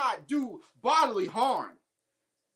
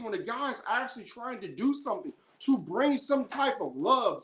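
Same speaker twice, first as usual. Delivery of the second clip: ordinary delivery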